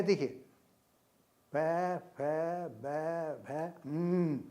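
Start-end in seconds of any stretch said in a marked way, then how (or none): 1.6-4.4 each sound alone and drawn out, ending in a hummed हम्म; articulation demo. Shown as a demonstration. Teacher showing how nasals are pronounced, isolated sounds ending in nasal हम्म. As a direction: isolate प, फ, ब, भ, then hum हम्म through the nose. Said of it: "ये है उसका उच्चारण".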